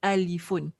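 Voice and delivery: "Alifun" with its -un ending voiced; full Arabic form. Shown as a demonstration. Teacher correcting the spelling aloud, full -un ending. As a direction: say all of "Alifun", final -un included. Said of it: "we call it alifun".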